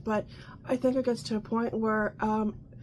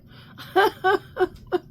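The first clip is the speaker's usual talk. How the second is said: high bursting laugh; real laughter; 8 semitones higher, 5 dB louder than usual. Real, not acted. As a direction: laugh out loud at the thought. Laughing at hair straightener joke.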